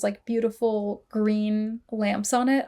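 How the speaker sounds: ordinary talk, nothing marked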